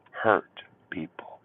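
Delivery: slow whispered "Hurt people", almost breathed. Shown as a demonstration. To his viewers, a slow whispered warning. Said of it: "repeated as whisper".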